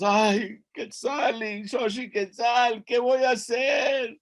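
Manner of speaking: high, wailing mock lament; crying. A narrator's reading. Hero lamenting the broken bones to Quetzali, acted crying. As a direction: cry out helplessly in a high voice. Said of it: "begins to cry".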